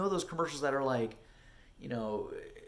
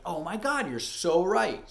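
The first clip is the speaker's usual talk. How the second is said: higher, louder burst of agreement; excited realisation; 6 semitones higher, 6 dB louder, slower than usual. Retold or imitated. Actor replying to friends' callback advice, excited realisation. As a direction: play up the sudden realisation.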